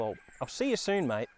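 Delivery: ordinary delivery, nothing marked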